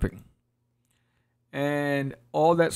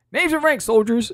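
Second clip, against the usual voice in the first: much higher, louder barked command; commanding order; 9 semitones higher, 5 dB louder than usual. Acted. New king demanding names from his guards, loud command. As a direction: snap an order at the soldiers.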